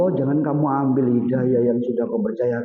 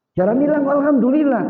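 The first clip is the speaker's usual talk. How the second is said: exasperated wife; 11 semitones higher, 4 dB louder than usual. Retold or imitated. Wife exasperated at his thanks.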